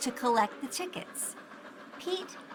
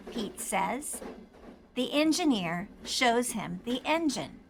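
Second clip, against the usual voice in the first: ordinary delivery, nothing marked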